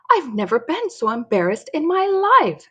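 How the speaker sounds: high-pitched embarrassed complaint; exasperated mom. Acted. Mom complains about Dory to the family, mortified.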